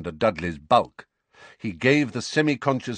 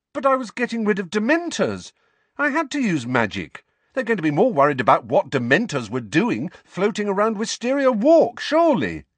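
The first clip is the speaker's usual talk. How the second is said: put-on protesting teen; 11 semitones higher, 4 dB louder than usual. A narrator's reading. Harry arguing.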